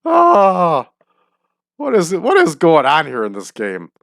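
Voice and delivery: high, sighing recovery from laughter, falling back down; exasperated wonder after a real laughing fit; amused disbelief. Real, not acted. Recovering from laughing at game absurdity.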